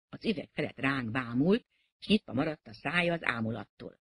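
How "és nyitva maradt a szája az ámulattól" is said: ordinary delivery, nothing marked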